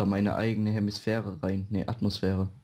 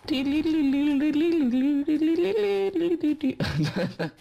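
long high shrill whirr; high whirring spin noise; 5 dB louder than usual. Pretend. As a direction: screech like a spinning top.

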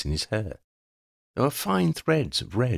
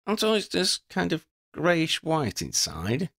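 quibbling; 7 semitones higher than usual. Acted. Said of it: "Lydia quibbled".

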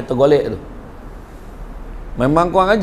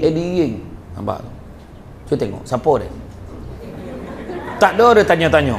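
normal talking voice

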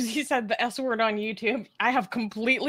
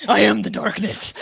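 laughing mock Batman growl, louder; 7 dB louder than usual. Cohost jokes the manly voice is Batman, laughing. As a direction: ham up a caped-crusader line while giggling. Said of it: "She's Batman".

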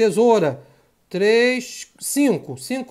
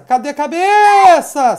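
loud, higher, stretched-out question; frantic yell; 4 semitones higher, 8 dB louder than usual. Real, not acted. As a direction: shout it in a mid-game panic. Em apuros, desviando no jogo. (grito afobado e arrastado)

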